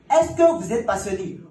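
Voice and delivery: loud, slow demanding question; insistent director. Retold or imitated. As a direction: press him hard for the answer. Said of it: "Il reprend la question".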